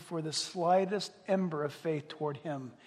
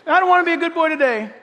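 put-on high, loud whiny little boy; defiant grandson, loud mock child; 9 semitones higher, 13 dB louder, faster than usual. Grandpa mimicking his defiant grandson, high and loud. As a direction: shout it like a stubborn three-year-old.

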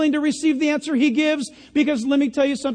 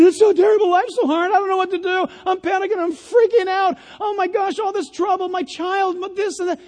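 high-pitched, hammed-up freak-out; mock panic; 7 semitones higher, 3 dB louder than usual. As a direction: wail about your troubles in exaggerated panic. Acting out people panicking in trouble.

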